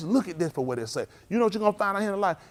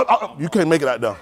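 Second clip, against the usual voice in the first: ordinary talk, nothing marked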